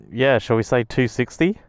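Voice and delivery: usual speaking voice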